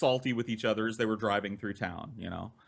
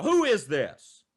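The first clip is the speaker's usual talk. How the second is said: mild mock trucker scolding, louder; gruff trucker; 9 semitones higher, 4 dB louder than usual. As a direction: scold the kid like an annoyed trucker. Angry truckers voiced.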